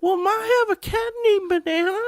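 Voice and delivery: very high, tearful cat lady; squeaky old cat lady; crazy cat lady voice. Acted. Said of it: "I am a crazy cat lady".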